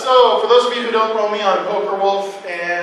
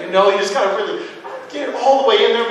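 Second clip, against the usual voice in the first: ordinary talk, nothing marked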